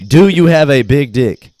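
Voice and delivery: the host grilling a caller, bluntly; blunt loud question. Retold or imitated. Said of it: "And then he's like".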